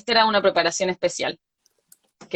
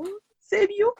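surprised; 12 semitones higher, 4 dB quieter, slower than usual. Retold or imitated. Invited to dinner by clerk.

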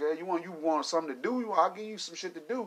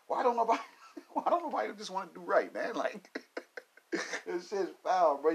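laughing complaint; 4 semitones higher, 4 dB quieter than usual. Real, not acted.

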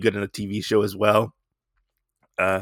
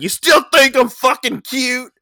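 higher, louder mock-menacing taunt; taunting growl-off; 13 semitones higher, 5 dB louder than usual. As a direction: throw his words back at him. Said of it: "Monster Girl's like".

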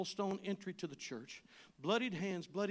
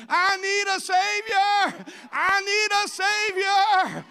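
shouted, slow and much higher; desperate, drawn-out plea at full voice; crying all the louder; 12 semitones higher, 15 dB louder, much slower than usual. Retold or imitated. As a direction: blind beggar shouting over the crowd for Jesus. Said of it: "he cried all the louder".

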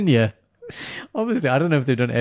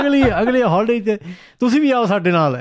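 put-on Punjabi accent; 9 semitones higher, 5 dB louder, much faster than usual. Repeating the uncles' invitation.

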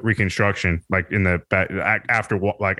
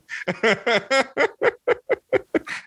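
long rising laugh. Real, not acted. Cracking up at own joke to a friend, rising laugh. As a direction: giggle rising up high.

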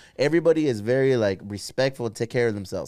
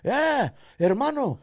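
high, slow put-on Irish local voice; hammed-up friendly Irish local; imitating Irish locals; 10 semitones higher, 4 dB louder than usual. Retelling Dublin locals welcoming the Mexican visitors, hammed-up high Irish voice.

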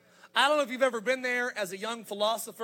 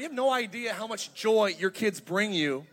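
normal talking voice

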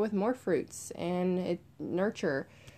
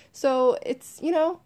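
usual speaking voice

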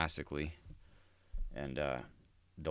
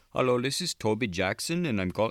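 higher, louder scripted caller greeting; telemarketer voice; 7 semitones higher, 6 dB louder than usual. Retold or imitated. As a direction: sound like a canned telemarketer pitch. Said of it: "They always call you and they're like".

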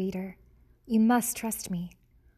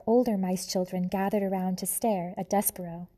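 usual speaking voice

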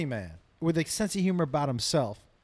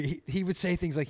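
ordinary talk, nothing marked

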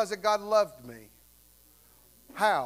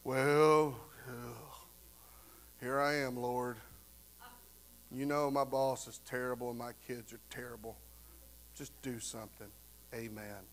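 low, soft, bored mock prayer; lazy mumbled prayer; 10 semitones lower, 9 dB quieter than usual. Careless churchgoer mumbling a lazy prayer, bored. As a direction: mumble a half-hearted prayer. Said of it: "You can't come to God with the".

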